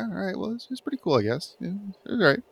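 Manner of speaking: high, mock-unimpressed shrug; underwhelmed. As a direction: sound let down but polite. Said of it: "it's like".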